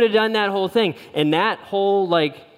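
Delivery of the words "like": normal talking voice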